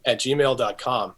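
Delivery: usual speaking voice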